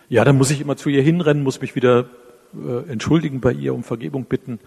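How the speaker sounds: a hint of a frantic husband on the phone; agitated complaint. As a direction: rush it like a man at his wit's end. Distressed caller retold.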